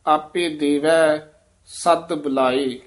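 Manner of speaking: ਸਦ firm and clipped in a slow line; slow line with a tight ਸਦ. Shown as a demonstration. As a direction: press ਸਦ hard and short. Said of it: "ਸਦ ਕੱਸ ਕੇ ਉਚਾਰਨ ਕਰਨਾ ਹੈ".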